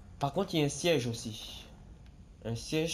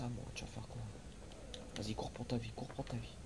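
ordinary talk, nothing marked

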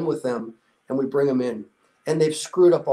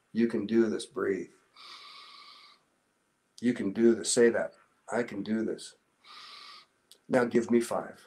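soft coaching; 5 semitones lower, 6 dB quieter than usual. Retold or imitated. Coaching exhausted recruit through burpees.

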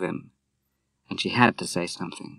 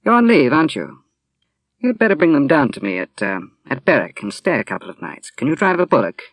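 Moira voiced; 5 semitones higher, 5 dB louder than usual. A narrator's reading. Moira voiced in read novel.

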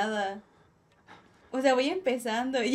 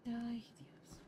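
whisper; 3 semitones lower, 14 dB quieter than usual. Real, not acted. Nervous alone in dark game.

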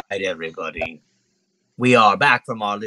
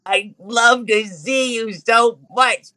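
high, slow, squeezed-out adoration; drawn-out lovestruck creature voice; besotted little monkey; 10 semitones higher, slower than usual. Acted. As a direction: gush while clinging to your crush. Monkey hugs and humps her leg.

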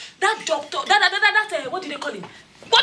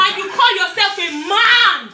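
loud, slow, raised scolding; angry shout; 3 semitones higher, 5 dB louder, slower than usual. Acted. Wife yelling at her jobless husband, angry. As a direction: berate him loudly.

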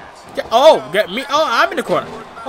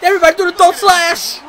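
rally the arena; excited ring announcement; hyped crowd call; 8 semitones higher than usual. Pretend. Hyping the crowd as wrestler.

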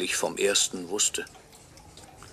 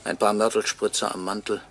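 normal talking voice

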